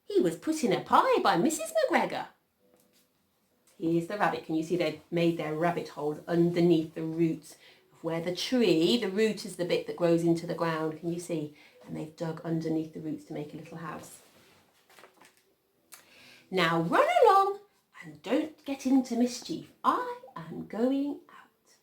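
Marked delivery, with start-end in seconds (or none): 0.0-2.3 high, emphatic motherly warning; mother rabbit. A narrator's reading. Mrs Rabbit warning of McGregor's garden, high motherly voice. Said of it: "said old Missus Rabbit one morning". 16.5-21.8 high, lilting motherly send-off; mother rabbit. A narrator's reading. Mrs Rabbit sending her children out, high motherly voice.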